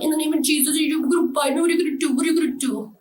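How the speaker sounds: high, sing-song jeer; mocking mimicry. Devil mocking her binding prayer, high jeer.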